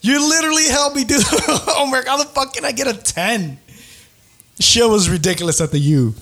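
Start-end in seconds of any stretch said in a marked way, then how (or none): 0.0-3.6 laughing in mock outrage at the TA; outraged laughing. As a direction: squeal the complaint through laughter. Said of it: "He was like".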